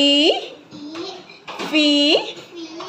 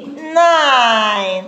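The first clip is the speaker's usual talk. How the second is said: long, slowly stretched "nine"; drawn out; 3 semitones lower than usual. Shown as a demonstration. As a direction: stretch "nine" right out for the pupil to follow. Teacher models word after spelling it. (kata "nine" diucapkan panjang dan pelan)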